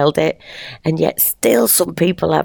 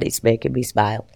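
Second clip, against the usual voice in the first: ordinary talk, nothing marked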